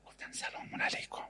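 soft reverent whisper; mock devout whisper; hushed disciple. Disciple relays master's words.

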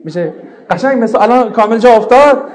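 words spilling into real laughter; laughing. Real, not acted. Teacher cracking up at her own example, laughing. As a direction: laugh through the line.

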